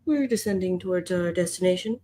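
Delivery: calm airline captain announcement; pilot voice. Acted. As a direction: speak like a captain over the intercom. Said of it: "I sound like a pilot".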